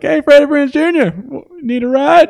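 high, loud cartoon call; hollering like a cartoon character offering a ride; hammed-up high cartoon shout. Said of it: "or he's like".

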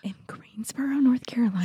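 shocked whispered question. Real, not acted. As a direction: gasp it back in a hushed whisper. Listener shocked by the confession.

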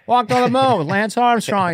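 high, yelled-out politician brag; excitable stumbling statesman; Joe Biden. Acted. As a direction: call it out in a high rambling voice. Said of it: "You know how he yells".

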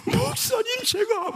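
very high mock sobbing wail; Donghwan's tearful protest. Young man begs his pastor, mock tearful wail. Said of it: "막 울먹거리는 거야".

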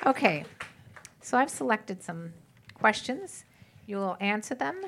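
usual speaking voice

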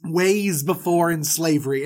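ordinary talk

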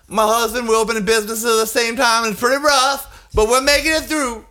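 high-pitched, put-upon hostess announcing her husband; mock hostess speech. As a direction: imitate a struggling hostess at the podium. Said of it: "And then she's like".

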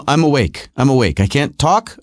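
normal talking voice

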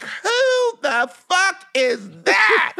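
very high, long-drawn shocked question; mock outrage. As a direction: stretch every word as scandalized moms would. Mothers' shocked reaction, acted.

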